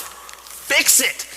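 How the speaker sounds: loud, high, very slow command; hammed-up demand; commanding shout. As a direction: bellow the order at the crowd. Speaker's climactic command.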